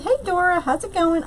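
ordinary talk

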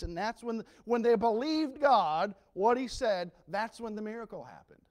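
loud, high, rising preacher cry; preaching at full force. Acted. Preacher crying out to the church, loud and high. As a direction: hammer the point home to the congregation.

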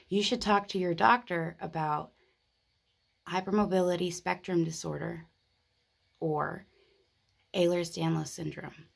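ordinary delivery

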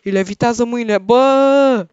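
high, loud commentary ending in a yell; alarmed. Real, not acted. Player watches Grimace chase him with floating hands, loud alarmed cry. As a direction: call out in mounting alarm as he gives chase.

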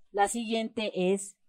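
ordinary delivery

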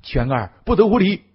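high, urgent reprimand; stern shout. A narrator's reading. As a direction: shout in alarm to stop a rude child. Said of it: "大喝道".

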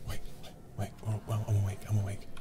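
ordinary talk, nothing marked

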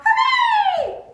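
excited chirp. Acted. Chick cheers on finding the egg, loud chirpy hooray. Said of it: "Chick chirps aloud".

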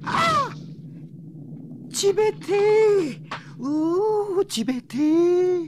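high, loud cry of cold; cold shock yelp. Acted. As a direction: yelp at the icy water. Man feels cold water.

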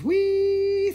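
long, high-pitched held whee; playful squeal. Pretend. Seller celebrating a thirty dollar price, playful high squeal.

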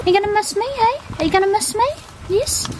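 high, loud doggy-talk questions. Real, not acted. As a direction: squeal the questions up at the dog. Asking puppy in baby talk.

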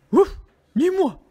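loud woof, then short refusal; dog voice. A narrator's reading. Dog refusing to help the hen, loud barking voice. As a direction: bark it out like a dog. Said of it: "dit le chien".

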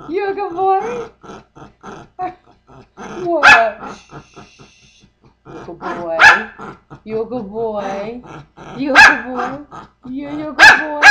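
loud, high excited praise; excited pet talk. Real, not acted. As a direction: hype up the dog.

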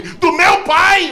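high, loud, drawn-out mock shout; hammed-up shocked question; horrified disbelief. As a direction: stretch it out in shrieked disbelief. Father's absurd misunderstanding, acted.

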